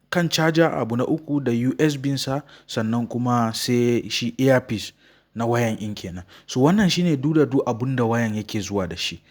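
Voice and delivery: ordinary delivery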